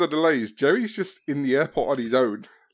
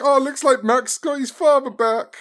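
quoting Jerry; 9 semitones higher, slower than usual. Retold or imitated. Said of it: "he even turns like".

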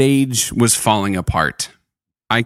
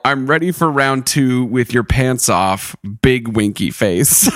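usual speaking voice

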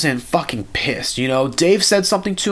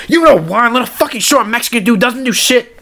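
higher, louder mock rant; mock yell; 8 semitones higher, 3 dB louder than usual. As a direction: rant like the angry boss. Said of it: "He goes".

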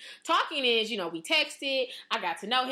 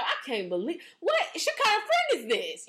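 mock outrage; 8 semitones higher than usual. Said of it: "I'd be like".

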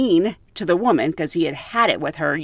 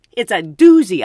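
loud doozy; 10 dB louder than usual. Real, not acted.

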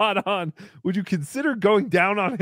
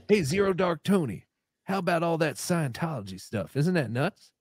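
low put-on character voice; 7 semitones lower, 6 dB quieter than usual. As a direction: drop into a low slurry character.

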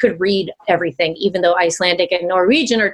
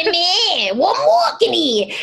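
high, hammed-up New York accent; 9 semitones higher than usual. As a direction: imitate an indignant New Yorker, pitched way up. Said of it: "the New York accent".

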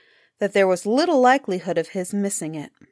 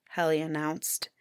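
ordinary delivery, nothing marked

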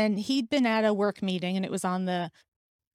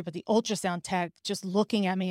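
usual speaking voice

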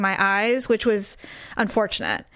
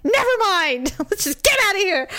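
mock exasperation; 9 semitones higher than usual. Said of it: "I'm like".

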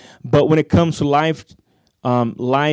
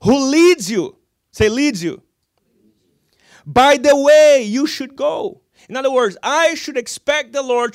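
full-force preaching; 10 semitones higher, 6 dB louder than usual. Acted. Preacher urging the congregation to repeat, loud and high. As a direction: drive the words home to the crowd.

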